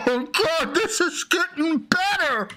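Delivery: laughing high. Real, not acted. Laughing at the story.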